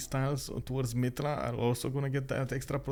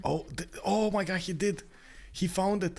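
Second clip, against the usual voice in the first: excited; 7 semitones higher than usual. Real, not acted. Caster reacting to a strong move.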